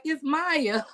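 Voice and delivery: high mock correction; a mock correcting Maya; light prissy correction; imitating a correction. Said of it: "she like".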